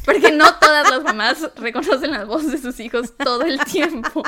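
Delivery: laughing. Real, not acted. Laughing while defending the mom.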